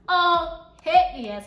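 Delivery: bright, loud eager yes; excited yes. Retold or imitated. Eager reply to an appetizer offer.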